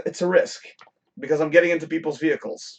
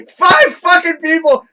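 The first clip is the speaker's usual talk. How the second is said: loud, high, slowed words through laughter; shouting through laughter; 9 semitones higher, 7 dB louder, slower than usual. Real, not acted. Repeating to viewers how many crashed, loud laughing. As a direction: yell it while still laughing. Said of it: "That's why I'm laughing so hard".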